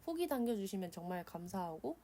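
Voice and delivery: ordinary talk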